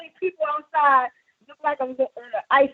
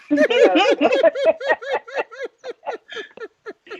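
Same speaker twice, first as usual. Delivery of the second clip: long laugh; 10 semitones higher than usual. Real, not acted. Caller laughing at own fall.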